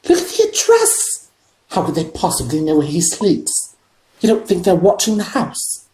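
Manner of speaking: frightened wife calls him; quivering voice. A narrator's reading. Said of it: "in a quivering voice".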